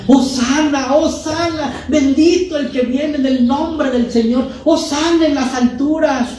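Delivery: high exultant crowd cry of Hosana; crowd acclaiming. Retold or imitated. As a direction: call it out like a jubilant crowd. Crowd cheering Jesus, Matthew 21.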